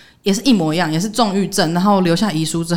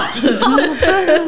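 stunned laugh; 10 semitones higher, 5 dB louder than usual. Real, not acted. Laugh after the shocked reaction.

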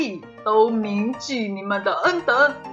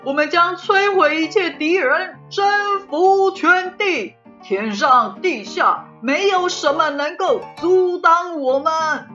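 loud, higher, rousing tyrant king; rally the troops like a tyrant; rousing king speech; 7 semitones higher, 6 dB louder than usual. Acted. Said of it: "双手在空中用力挥动".

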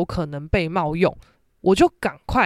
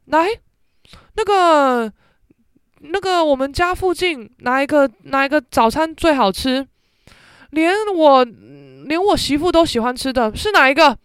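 higher, louder mother firing off a test question; mom quizzing; 7 semitones higher, 5 dB louder than usual. Retold or imitated. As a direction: lightly voice mom setting the quiz. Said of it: "她说".

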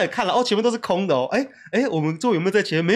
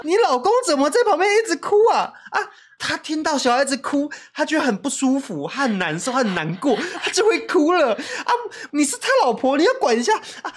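high, louder complaining voice; annoyed passenger; 10 semitones higher, 4 dB louder than usual. Pretend. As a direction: play a fed-up passenger scolding the wife. 扮演抱怨的乘客. (扮演不满的乘客，高声抱怨)

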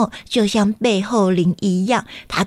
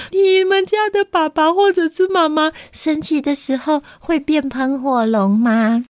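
ordinary delivery

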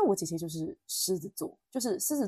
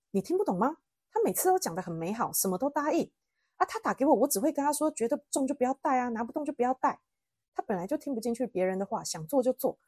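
higher-pitched, exasperated retold rant; 模仿姐姐生气; 5 semitones higher than usual. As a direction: act out an angry sister scolding her sibling. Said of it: "我姐现在真的很生气". (模仿姐姐生气地连声数落，语调拔高)

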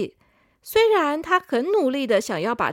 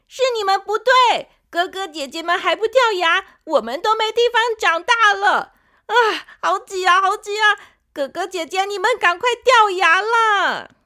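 high, loud bratty outburst; angry; 8 semitones higher, 6 dB louder than usual. Acted. Younger tooth angrily tells elders to fall out, loud. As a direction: shout that it's their fault. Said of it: "他生气地说".

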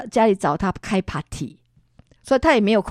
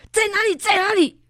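sharp high demand; mother fiercely asking where the ghost is; 12 semitones higher, 4 dB louder than usual. Retold or imitated. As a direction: bark it out to hide the fear. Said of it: "我就很凶的讲".

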